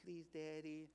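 soft, small pleading daughter's voice; soft, sweet voice of a daughter begging her dad; sweet little girl voice. Retold or imitated. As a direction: coax like a little girl asking Daddy. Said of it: "she got this sweet little voice".

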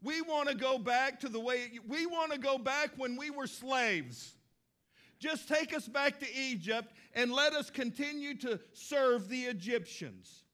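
a hint of whiny pleading; whiny people. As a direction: beg to go back, a bit whiny. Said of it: "they're saying".

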